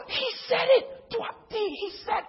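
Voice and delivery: high squeaky, stammering thrill; amazed excitement. Retold or imitated. Newcomers hearing the pastor confirm it, squealing with excitement. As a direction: squeal it like you can't believe your ears.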